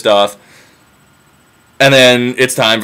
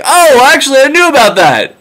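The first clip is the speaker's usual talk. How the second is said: much higher, louder caught-out yelp; sheepish blurt; 12 semitones higher, 4 dB louder than usual. Retold or imitated. Telemachus caught out by his dad, high loud sheepish blurt.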